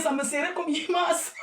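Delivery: slow, laughing through the words; laughing relief after taking off the wig. Real, not acted. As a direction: giggle it out while complaining. Laughing about tight wig.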